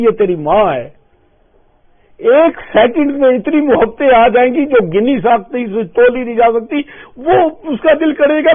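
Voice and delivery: a fervent sermon rising to a cry; impassioned preaching. Acted. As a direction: preach at full force, building up. Preacher builds to full force.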